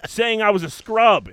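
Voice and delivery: high, loud mock complaint; indignant protest. [name] complaining about being called a scrub, indignant.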